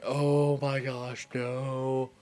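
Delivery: mock panic. Imagining life without an inner monologue, hammed-up panic. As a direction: play up a slow-motion panic attack. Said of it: "have a straight up panic attack".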